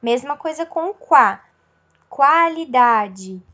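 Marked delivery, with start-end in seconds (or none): 2.1-3.4 Qualidade stretched slowly with the U heard in qua; slow sounded-out U. Shown as a demonstration. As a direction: draw out Qualidade so every syllable is clear. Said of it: "eu consigo ouvir o cuá". (Qualidade dita devagar, com o U audível)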